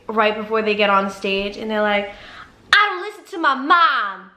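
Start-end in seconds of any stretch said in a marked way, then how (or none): 2.7-4.3 put-on higher, sassy teen guest voice; mocking Maury guest. Mocking a teen guest on Maury, much higher and sassy. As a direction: mimic a bratty girl about to go on stage. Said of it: "you know on Maury ... they're like".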